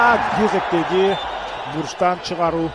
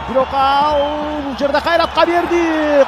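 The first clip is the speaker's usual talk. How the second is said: rising excitement; 10 semitones higher, 8 dB louder than usual. Real, not acted. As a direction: voice climbing high and loud. Attack builds, voice climbing. (көтөрүлгөн толкундануу)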